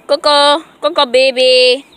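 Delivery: loud call to the dog. Real, not acted. Calling dog.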